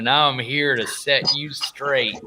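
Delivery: slow, drawn-out bad cowboy drawl; John Wayne. Knight introducing himself to the bad guys, bad John Wayne impression. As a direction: introduce yourself like a hammed-up Western hero. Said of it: "the worst John Wayne impression".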